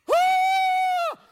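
long high-pitched scream; comic, piercing wail of pain; mock pained scream. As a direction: shriek as if your eyes are burning. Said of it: "I'm screaming out".

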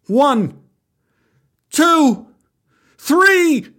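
high, building count; rising shouted countdown with long gaps; called-out countdown. Acted. Boy calls out his countdown again.

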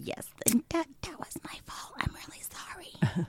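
sheepish whisper; whispered apology. Real, not acted. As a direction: whisper it, a little guilty. Sheepish apology for missing recording.

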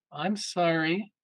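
slow, soft, questioning apology; puzzled apology. Retold or imitated. Replying to a lady's odd complaint, puzzled. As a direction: draw it out softly with a questioning rise.

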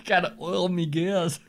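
high, slow laughing pirate robot; rusty robot. Pretend. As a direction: ham up a rusty old machine. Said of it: "It's like Shiver Me Timber".

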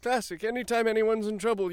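ordinary talk, nothing marked

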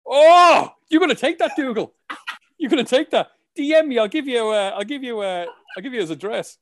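mock outrage. Real, not acted. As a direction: egg on the co-host, playfully riled up. Teasing co-host about insult.

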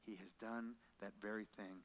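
soft, reverent near-whisper; hushed and solemn. Real, not acted. Preacher reflecting on the Lamb of God, hushed reverence. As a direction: drop to a hush of awe.

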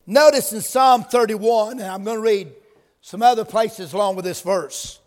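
ordinary talk, nothing marked

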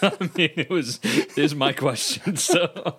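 talking through laughter; laughing. Real, not acted. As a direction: giggle through the words. Laughing through his explanation.

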